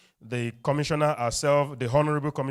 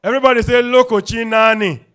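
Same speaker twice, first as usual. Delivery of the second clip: loud, raised MC call; crowd call-out; 7 semitones higher, 11 dB louder than usual. Real, not acted. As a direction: rouse the hall to repeat it.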